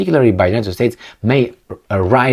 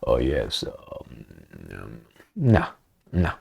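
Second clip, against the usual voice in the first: lower, much softer, timid; mock meek hesitation; 12 semitones lower, 9 dB quieter, slower than usual. Diplomats imagined as timid pushovers, soft mock hesitation. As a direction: hem and haw like a pushover. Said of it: "Do you think they're gonna be like".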